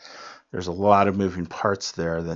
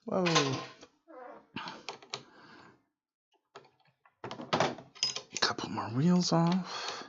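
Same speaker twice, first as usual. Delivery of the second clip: straining with effort; 8 semitones higher, 7 dB quieter, slower than usual. Real, not acted. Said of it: "Sorry about all the grunting and groaning".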